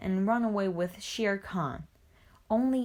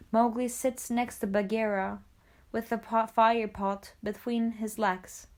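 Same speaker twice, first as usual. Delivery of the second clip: usual speaking voice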